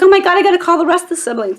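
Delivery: fast, high and loud; excited rush. Retold or imitated. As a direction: gabble it out, bursting to spread the news. Sibling rushing to tell the others.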